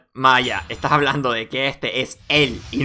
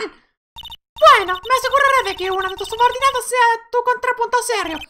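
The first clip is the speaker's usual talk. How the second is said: very high put-on courtroom voice; haughty prosecutor. Prosecutor calls the next witness, high-pitched and formal.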